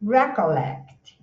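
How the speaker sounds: Recollect, slow, with the weight on LECT; stress on lect. Shown as a demonstration. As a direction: stress the last syllable of Recollect, slowly. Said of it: "O stress tá no lect". (acento em LECT, devagar)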